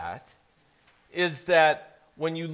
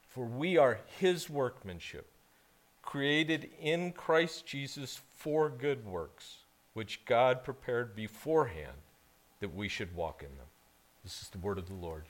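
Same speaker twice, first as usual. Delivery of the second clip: scripture reading; 4 semitones lower, 7 dB quieter than usual. Acted. Pastor reading scripture to the congregation, solemn. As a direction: read the verse with reverence.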